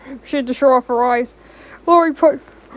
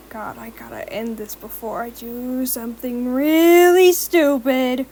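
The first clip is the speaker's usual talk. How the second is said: sighing; slower than usual. A narrator's reading. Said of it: "Rochelle sighed".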